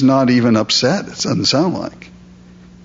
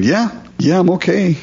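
higher, offhand "yeah, yeah"; casual reassurance; 7 semitones higher than usual. Retold or imitated. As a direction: shrug it off from inside the den. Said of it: "just kind of responding".

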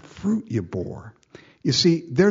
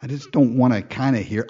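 ordinary talk, nothing marked

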